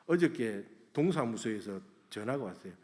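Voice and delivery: usual speaking voice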